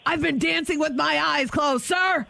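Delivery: high, barking soldier lyrics; drill sergeant voice. Acted. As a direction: bark song lyrics like orders. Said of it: "give me some more [name] lyrics".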